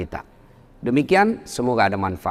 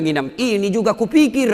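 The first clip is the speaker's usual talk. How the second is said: high-pitched, raised-voice comeback; imitated retort; 8 semitones higher than usual. Stuck imam answering the heckling student, retorting. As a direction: call it back high, for the laugh. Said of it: "Langsung dia bilang".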